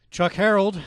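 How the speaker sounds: loud, high showman's intro; host introducing himself, loud and high; announcer name call. Real, not acted. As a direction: bellow the name like a ring announcer. Host announces his own name.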